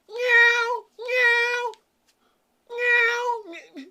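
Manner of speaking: very high, drawn-out meowing; mock cat meow. Person acting like a cat in the nut house, high meows. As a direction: wail like a pretend cat. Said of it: "I'm a cat".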